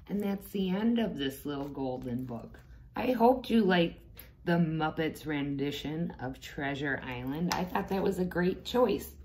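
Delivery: usual speaking voice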